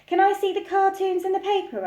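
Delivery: put-on high, quick little girl; child's voice. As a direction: rush it like an excited child. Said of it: "asked Laura".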